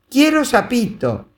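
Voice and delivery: put-on high, insistent child's demand; demanding little girl. A narrator's reading. Little girl demanding the toad, high childish insistence. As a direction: stamp it like a toddler. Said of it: "exigía la niña".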